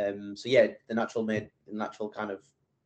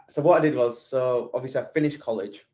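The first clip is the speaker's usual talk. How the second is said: ordinary talk, nothing marked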